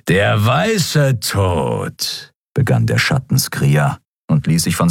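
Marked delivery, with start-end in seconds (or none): 0.1-2.3 very slow, drawn-out taunt; menacing villain. A narrator's reading. Shadow Skria greeting his former brother, menacing drawl. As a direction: stretch the title out with menace.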